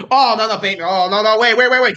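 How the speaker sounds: real panic building as the words pile up; alarmed. Real, not acted. Fan fears the Jets will take Bateman, alarmed. Dreads the Jets taking his player.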